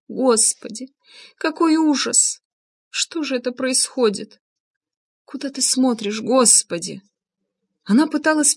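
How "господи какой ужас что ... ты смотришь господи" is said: high, grieving plea; wailing lament. A narrator's reading. Анна over Влад's body, wailing lament. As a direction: lament slowly in a raised pitch. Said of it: "тихо запричитала Анна".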